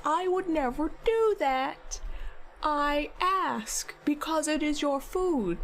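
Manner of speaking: explaining to her partner why her food is hers; retold exasperation. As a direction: spell it out, mildly exasperated. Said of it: "It was exasperated".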